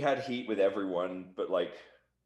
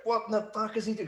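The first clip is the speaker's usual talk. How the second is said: imitating furious boss; 12 semitones higher than usual. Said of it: "Jeff and Dutch were furious".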